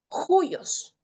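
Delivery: "juyos" starting with a Spanish jota sound; rough breathing J. Shown as a demonstration. Teacher shows students the rough breathing in juyos. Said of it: "se pronuncia como J".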